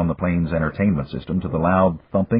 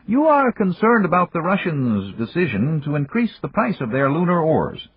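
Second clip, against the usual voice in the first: put-on higher, measured voice of an old friend; Japanese magnate; 10 semitones higher than usual. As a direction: voice the older businessman, courteous and calm. Yamagata speaks.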